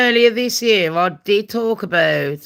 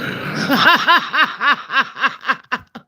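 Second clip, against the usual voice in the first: higher, long stuttering laugh; 3 semitones higher than usual. Real, not acted. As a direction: burst into a long run of laughter. Laughing after accent joke.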